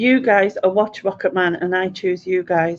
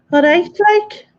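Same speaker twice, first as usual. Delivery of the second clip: put-on Liverpool accent, much higher and louder; 9 semitones higher, 5 dB louder than usual. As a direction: put on a Scouse greeting, pitched way up. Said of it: "hoped it sounded a bit Liverpoolish".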